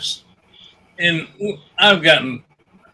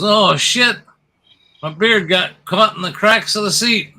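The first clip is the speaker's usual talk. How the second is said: startled yelp; 6 semitones higher than usual. Acted. Frank yelps as his beard gets caught, startled and higher. Husband yelps in told joke.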